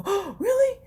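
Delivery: high, eager oh really; excited. Retold or imitated. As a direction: jump up in pitch like a thrilled kid. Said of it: "and he's excited".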